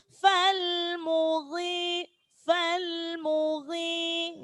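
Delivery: "Fal mughi" slowly, the i held one beat; slow recitation. Shown as a demonstration. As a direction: stretch the i in "mughi". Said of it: "maka dibaca matobi'i". ("fal mughi" dibaca mad thobi'i)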